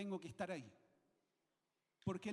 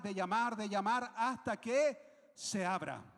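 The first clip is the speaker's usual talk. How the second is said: loud, insistent exhortation; preaching; 3 semitones higher, 7 dB louder than usual. Real, not acted. Preacher urging the congregation to pray with tenacity, forceful preaching. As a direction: drive it home forcefully to the congregation.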